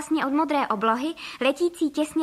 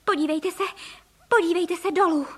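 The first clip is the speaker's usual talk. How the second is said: raised, urgent call; alarmed cry; 6 semitones higher than usual. A narrator's reading. Mrs. Frog urging her husband to look down, alarmed. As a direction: call out in alarm, pitch lifted. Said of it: "vykřikla".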